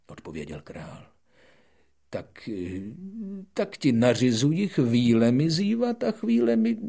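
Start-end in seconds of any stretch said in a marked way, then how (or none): 2.1-6.8 loud, high flustered king stumbling over words; stammering king. Acted. Flustered king stumbling through his command, loud. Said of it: "trochu breptal".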